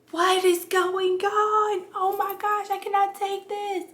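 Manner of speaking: high, slow, strained wail of disbelief; panicked labor cry. Retold or imitated. To her doula mid-contraction, a high, strained panicked plea.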